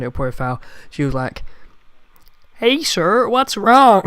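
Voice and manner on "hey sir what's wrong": put-on high, loud American girl voice, deliberately overdone; hammed-up high American accent; cute American girl. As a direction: squeak it up like a cute American call-centre girl. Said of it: "I'll try and do an impression".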